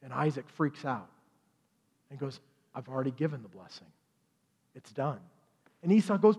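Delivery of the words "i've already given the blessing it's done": quick, much softer, voice dropping away; hushed dismay. Retold or imitated. Isaac telling Esau the blessing is gone, hushed and quick. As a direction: let it fall out in a rushed hush. Blind father refusing Esau.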